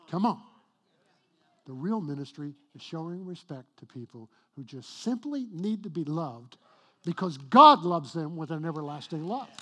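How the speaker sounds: rising preacher's call, loud; preaching. Acted. Pastor to congregation, loud preaching. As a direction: drive the point home to the congregation.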